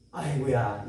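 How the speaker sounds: low, drawn-out aigoo; mock groan of pity. Preacher groans in sympathy at the girl's plight, low. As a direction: sigh it out like an old pitying aunt. Preacher's exclamation at her story.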